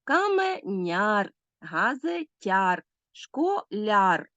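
"Каменяр, газетяр, школяр" slow and drawn out, stress on the final syllable; stressed suffix ЯР. Shown as a demonstration. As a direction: stretch each word and land on its ending in "Каменяр, газетяр, школяр". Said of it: "мають наголошений суфікс яр".

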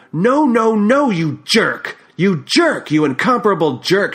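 high, loud furious outburst; shouted, scornful anger, slowing on the first jerk; exploding in anger. A narrator's reading. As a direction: she blows up and yells at him. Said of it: "she exclaimed".

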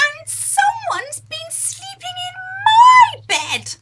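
very high, loud crying shout of a cub; wailing Baby Bear. Acted. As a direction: cry out like a little bear who found an intruder. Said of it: "cried Baby Bear".

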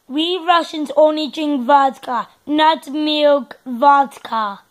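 mock Russian accent, heavy and firm. Kid redoing his Russian accent line, firmer. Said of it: "let's just do Russia".

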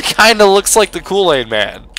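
ordinary delivery

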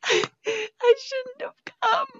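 very high slow hammed-up wail; drawn-out theatrical cry; mock wail. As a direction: stretch it out into a fake sob. Mock despair repeated, wailing.

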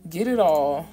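usual speaking voice

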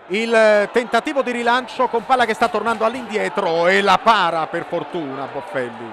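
usual speaking voice

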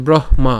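ব্রহ্মা as a firm "broh-ma"; h sounded first. Shown as a demonstration. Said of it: "হয়ের উচ্চারণটা আগে হচ্ছে". (ব্রহ্মা, আগে হ উচ্চারণ)

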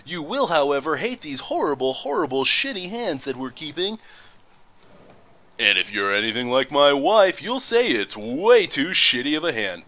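booming radio announcer. Acted. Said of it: "what you just witnessed there was my, uh, radio voice".

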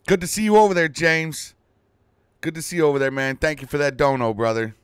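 loud, high hyped greeting; excited shout-out. Real, not acted. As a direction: call it out to chat. Greeting a donor on stream.

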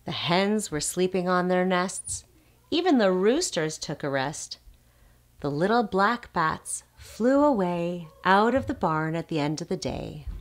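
ordinary delivery

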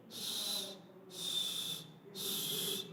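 soft unvoiced স- hisses; hissed s demo. Shown as a demonstration. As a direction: let the air out on স-. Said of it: "একটু জিহ্বার এটাকে". (জিহ্বা দিয়ে বাতাসে স-)

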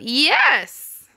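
loud, high-pitched exclamation; sudden jubilant burst; excited cry. Acted. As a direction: cry it out in triumph. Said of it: "The cat cried out".

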